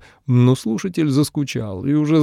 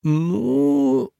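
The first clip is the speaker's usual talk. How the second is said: high, stretched hesitant ну; drawn out; 11 semitones higher than usual. Acted. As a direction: drawl the word out unsure. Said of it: "протянул обладатель".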